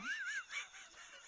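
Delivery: high squealing laugh; a sudden shriek of laughter. Real, not acted. Burst of laughter.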